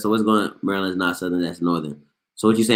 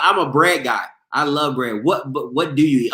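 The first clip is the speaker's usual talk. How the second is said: ordinary talk, nothing marked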